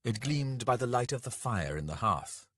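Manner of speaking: usual speaking voice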